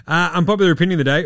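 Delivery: fast, loud announcer intro of the segment; host punching out his segment title in a rush. Acted. Host announces his podcast segment, fast and loud.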